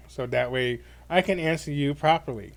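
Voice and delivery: ordinary delivery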